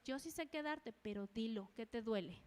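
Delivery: gentle, tender. Acted. The Lord asking a hurting believer, gently. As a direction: drop low and soft, inviting confession. The Lord answering, against mother's voice.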